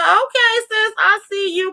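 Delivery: high-pitched sassy hype; cheering her on. Retold or imitated. Hyping up the girlfriend for standing up to him, high squeal. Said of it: "I said".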